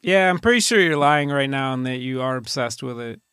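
normal talking voice

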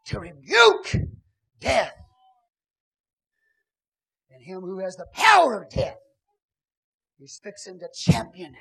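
loud, high, ringing preacher cry; full-force preaching. Acted. Preacher thundering to the congregation at full force. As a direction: preach it out to the rafters.

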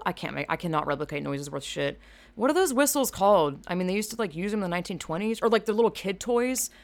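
ordinary talk

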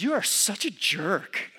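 acted angry wife telling off her husband; wrong-way confrontation. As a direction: play an accusing, fed-up spouse. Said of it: "the wrong way to confront me".

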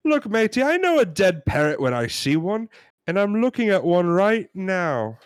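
high, indignant British customer; hammed-up British complainer; British accent. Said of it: "We're gonna try our British accents".

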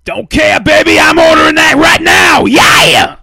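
loud, high excited yells; excited shouting. Real, not acted. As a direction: shout it out, hyped up. Hyped about ordering the book.

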